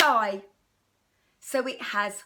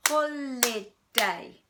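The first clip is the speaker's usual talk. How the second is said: "Holiday" slow, in three louder beats, hol-i-day; clapped syllables; 3 semitones higher, 4 dB louder, slower than usual. Shown as a demonstration. Teacher clapping "Holiday" slowly for pupils. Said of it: "We clap three times".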